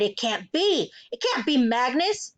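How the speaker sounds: mildly horrified, altered voice; a touch of panic; cried in disbelief. A narrator's reading. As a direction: refuse to believe it, aghast. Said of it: "she cried".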